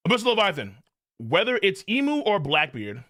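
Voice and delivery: normal talking voice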